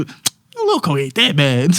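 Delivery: slow, put-on imitation voice; hammed-up mimicry, dragged out; mock somebody voice. Accused man parodying how they quoted him, slow mock voice. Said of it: "I sound like somebody".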